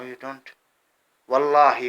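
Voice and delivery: normal talking voice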